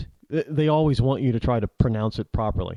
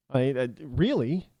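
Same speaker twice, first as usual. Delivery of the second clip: ordinary delivery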